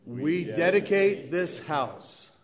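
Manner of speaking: slow congregational response in unison; recited with gusto. Acted. Congregation answers the reader to dedicate the church, with gusto. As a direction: answer together, stretching each word firmly. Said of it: "say it with gusto".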